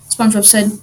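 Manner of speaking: usual speaking voice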